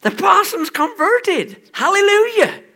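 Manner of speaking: loud, high, slow joyful shout; hammed-up Cornish preacher's cry of joy; ecstatic shout. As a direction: shout it out like a man overcome with praise. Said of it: "shouted in Cornish, in a Cornish manner".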